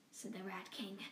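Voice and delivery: plain reading voice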